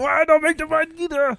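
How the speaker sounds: very high-pitched, hammed-up fretting; mock panic. Viewer constantly on edge at the movie, mock panic. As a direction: blurt it high, as a wound-up viewer. Acts tense viewer's outburst.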